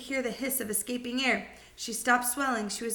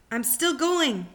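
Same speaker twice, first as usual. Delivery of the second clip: put-on lightly higher, louder old crone's screech; old screechy voice; 5 semitones higher, 4 dB louder, slower than usual. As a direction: screech it like a gleeful old woman. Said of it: "the old screechy voice".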